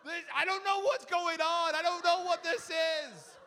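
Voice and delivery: baffled and cracking up; loud laughing confusion; laughing bewilderment. Retold or imitated. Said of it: "I'm like".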